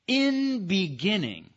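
In said high and slow, beginning falling after it; stress on In. Shown as a demonstration. Teacher showing the emphatic first word, stress on In. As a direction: hit In, then drop through beginning. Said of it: "putting the emphasis on the first word".

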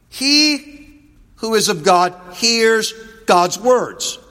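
loud, high, ringing preacher's reading; forceful scripture proclamation. Acted. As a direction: declare the verse to the whole congregation. Reads gospel verse forcefully.

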